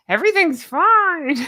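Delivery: forced cheerful teeth-clenched line; through gritted teeth. Pretend. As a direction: grit your teeth, insist all is fine. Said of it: "You have to say it through gritted teeth".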